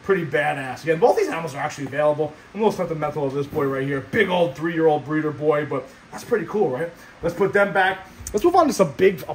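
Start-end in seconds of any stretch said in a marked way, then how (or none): 0.8-4.0 too much caffeine. Real, not acted. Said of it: "I'm going fast, huh?".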